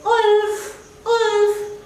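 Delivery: playful shouted alarm; shouting wolf. Acted. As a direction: call it out like a boy raising a false alarm. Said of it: "So he shouted".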